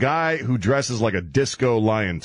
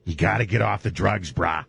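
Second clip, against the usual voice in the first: low laid-back bro voice, drops on brah; Dog the Bounty Hunter impression; 6 semitones lower than usual. Host demonstrates how Dog talks, low easy bro voice. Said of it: "This is how he talks".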